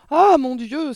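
high, loud gasp of horror; frightened exclamation. A narrator's reading. Mother hearing of a ghost, frightened exclamation. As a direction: cry out in sudden dread. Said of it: "s'exclama la mère".

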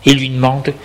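plain reading voice

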